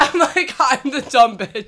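high and loud, laughing through the words. Real, not acted. Owning the joke to her cohost, laughing. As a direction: giggle the confession out.

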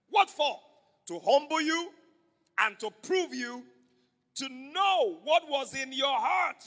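proclaimed bible reading; loud scripture reading. Acted. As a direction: boom the verse out with the church. Congregation reads verse loudly.

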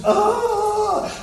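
sudden high anguished wail; wailing cry. Retold or imitated. Preacher recalling weeping over the church, high wail. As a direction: let out one retold wail of grief. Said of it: "all I could do was".

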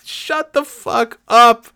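high, loud, slow outburst; exasperated yell. Retold or imitated. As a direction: shout it out drawn-out in comic exasperation. Exasperated reaction to the man.

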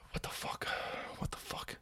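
breathless whisper of disbelief; stunned whisper. Real, not acted. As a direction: whisper it, unable to believe it. Shocked reaction to news.

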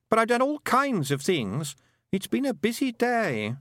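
put-on high boyish voice, losing certainty; boy trailing off. A narrator's reading. Roland realising he has been away longer, trailing off. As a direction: let the boy's high voice tail away in doubt. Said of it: "His voice trailed off".

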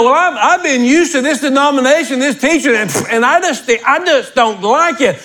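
high-pitched mimicry of a complainer; mock objecting churchgoer. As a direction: imitate a fussy church member balking at new teaching.

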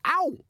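high sharp yelp of pain. Acted. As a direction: cry out from a clip round the ear. Boy hit on ear.

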